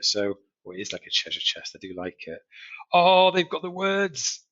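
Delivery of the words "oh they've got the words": high, slow delighted oh; mock surprise. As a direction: gasp at it like finding treasure. Mock delight at strategy box.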